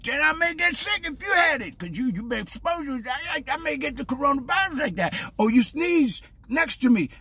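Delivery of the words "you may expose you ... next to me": ordinary talk, nothing marked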